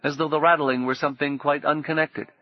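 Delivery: plain reading voice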